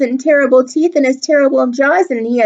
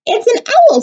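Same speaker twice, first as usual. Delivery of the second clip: mouse voice; 4 dB louder than usual. Acted.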